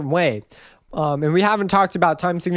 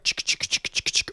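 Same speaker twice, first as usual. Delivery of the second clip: quick unvoiced "chick" noises; soft percussive mouth noise; imitated shaker; 7 dB quieter than usual. Shown as a demonstration. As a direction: make a shaker rhythm with the mouth. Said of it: "will have a shaker or a clap".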